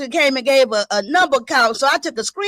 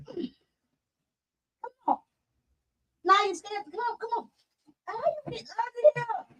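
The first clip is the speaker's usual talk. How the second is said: high, soft voice to a scared pet; coaxing; 9 semitones higher, 10 dB quieter than usual. Real, not acted. Owner calling scared puppy from under the bed, coaxing. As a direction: coax gently, pitched well up.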